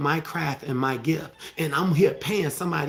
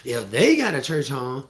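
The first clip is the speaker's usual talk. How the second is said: usual speaking voice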